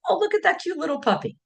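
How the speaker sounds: high, quick and delighted, like spotting a puppy; high fast aww voice; cooing over a puppy. Retold or imitated. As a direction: gush over something cute. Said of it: "Sometimes it says".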